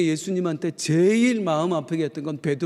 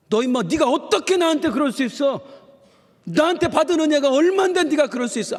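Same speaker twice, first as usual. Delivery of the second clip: higher, louder mock reproach; angry rebuke; 9 semitones higher, 4 dB louder than usual. Pastor acts out the rebuke Jesus did not give Peter, loud and high. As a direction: scold the betrayer furiously. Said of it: "아니".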